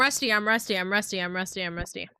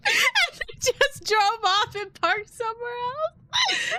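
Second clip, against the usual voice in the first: high, slow words through laughter; laughing; 14 semitones higher, 5 dB louder, slower than usual. Real, not acted. Telling chat how her coworker fled a crash, laughing high. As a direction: squeak it out while cracking up.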